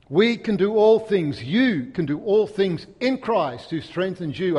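slow, loud and high declaration; rousing preaching. Acted. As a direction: proclaim it slowly to lift the room. Preacher rallies the congregation.

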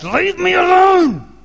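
high, drawn-out full-throated shout; desperate bellow to be left alone; loud yell. Retold or imitated. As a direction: yell it out slowly at the top of your voice. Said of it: "'cause I'd say out loud".

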